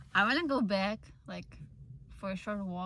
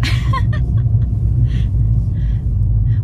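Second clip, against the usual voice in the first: giggling burst; bright airy laugh; high laugh; 11 dB louder than usual. Real, not acted. Laughing at crowd.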